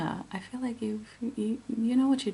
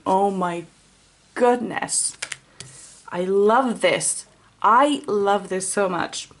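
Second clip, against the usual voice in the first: delighted; 6 dB louder, slower than usual. Real, not acted. Delighted by drawn card.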